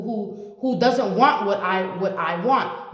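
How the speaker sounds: normal talking voice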